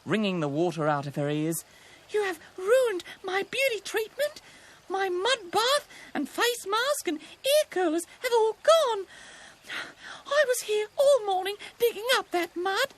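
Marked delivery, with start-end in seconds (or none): 2.1-9.1 high, wailing rabbit complaint; tearfully outraged rabbit; spluttering indignation. A narrator's reading. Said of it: "spluttered Roxy". 10.3-12.9 high, tearful rabbit wail; upset cartoon rabbit; spluttering indignation. A narrator's reading. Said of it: "spluttered Roxy".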